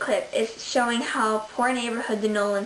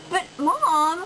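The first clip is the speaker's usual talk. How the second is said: nasal whine; 7 semitones higher than usual. Acted. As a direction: whine it at your mother. Child protests mother's decision.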